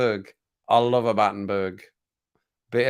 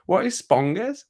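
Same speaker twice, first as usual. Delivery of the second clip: mocking nieces; 9 semitones higher, slower than usual. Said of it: "they were laughing, going".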